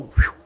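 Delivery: quick airy whoosh; a swift whistling whoosh. Shown as a demonstration. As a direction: imitate something flying off. Imitates flying by love.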